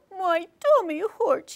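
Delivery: sick fireman. Shown as a demonstration. Fireman complains of his stomach in a mild whine. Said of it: "We did the high-low".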